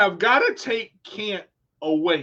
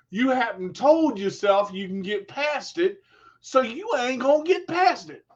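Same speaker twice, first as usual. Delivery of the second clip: normal talking voice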